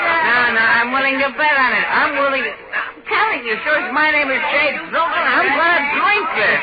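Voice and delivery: put-on drunk and stubborn, brushing them off; drunken boast. Drunk man insists on drinking.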